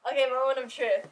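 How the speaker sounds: ordinary talk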